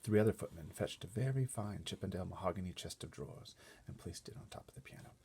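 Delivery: ordinary delivery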